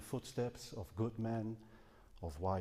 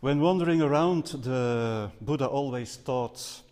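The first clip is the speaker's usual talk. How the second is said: raised voice, projecting to the room; louder on request; 3 semitones higher, 9 dB louder than usual. Real, not acted. Speaker obliges a listener who asked for more volume, louder. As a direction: speak up for the back of the hall. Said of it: "Could you speak louder".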